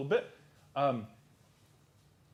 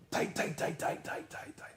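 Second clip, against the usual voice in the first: whispered huddle; 8 dB quieter than usual. Acted. Huddling around the fire.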